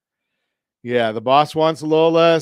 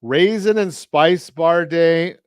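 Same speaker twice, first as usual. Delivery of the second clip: ordinary delivery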